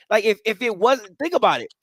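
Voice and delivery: ordinary talk, nothing marked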